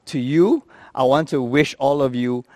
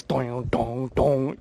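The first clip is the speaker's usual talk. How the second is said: soft, low "dong" chimes; imitated cabin chime; 3 semitones lower, 7 dB quieter, much slower than usual. Comic imitating the turbulence alert on a plane, soft chiming. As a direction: sound out a seatbelt chime, softly.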